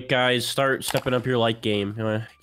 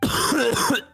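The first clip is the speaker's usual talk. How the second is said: hacking cough; sick cough; 13 semitones higher than usual. Real, not acted. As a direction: cough from a cold. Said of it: "let them hear your sick voice".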